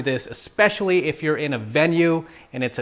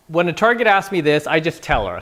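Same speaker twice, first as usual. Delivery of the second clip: normal talking voice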